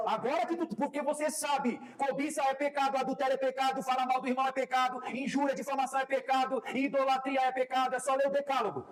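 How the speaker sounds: high, driving list of sins; preacherly rebuke. Retold or imitated. Preacher scolding a brother about sin, high insistent rebuke.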